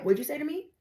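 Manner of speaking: high, sharp demand; confrontational challenge. Retold or imitated. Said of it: "You're like".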